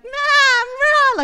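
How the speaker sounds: very high, loud bleating sheep; shrill imitated sheep call. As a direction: bleat like the sheep coming from the flock. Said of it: "this thing's like".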